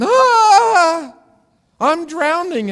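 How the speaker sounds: high, loud, half-laughing mock panic; panicked cry. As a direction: wail like someone sure they're drowning. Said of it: "standing before God going".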